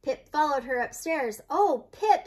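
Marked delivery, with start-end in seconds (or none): 1.5-2.3 high, dismayed sigh of words; a touch of storybook sorrow; mildly sad. A narrator's reading. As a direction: let the disappointment lift the voice. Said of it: "said Grandma Nan sadly".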